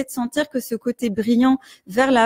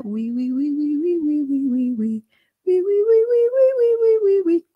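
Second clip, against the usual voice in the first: shy, sweet oui climbing much higher; gentle whining scale; 6 semitones higher than usual. Shown as a demonstration. Coach showing a gentle rising scale on oui. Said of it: "commencer à faire des gammes".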